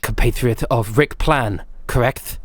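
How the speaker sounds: a hint of the weary, irritated officer; Faraday scolding Navina, lightly voiced exasperation; Faraday's way of talking. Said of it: "I forgot that's how he talks".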